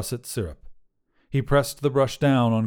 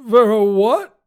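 high, loud incredulous question; baffled cry; 10 semitones higher, 9 dB louder, slower than usual. A narrator's reading. Wizard asks about the strange demand, loudly baffled.

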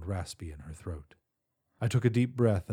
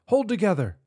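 higher, louder plea; desperate shout; 12 semitones higher, 7 dB louder than usual. Acted. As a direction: beg it with all you have. Urging the ship, louder.

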